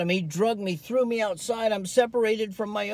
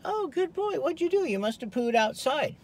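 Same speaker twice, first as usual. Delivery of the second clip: much higher, sweet praise for the dog; 8 semitones higher, 3 dB quieter than usual. Retold or imitated. Owner home to the dog, cooing much higher praise. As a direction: coo at the dog like it's a good boy.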